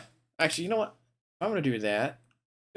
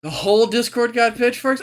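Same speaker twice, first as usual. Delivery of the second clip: louder, higher disbelieving repeat; incredulous echo; 7 semitones higher, 5 dB louder than usual. Real, not acted. Echoing chat in disbelief.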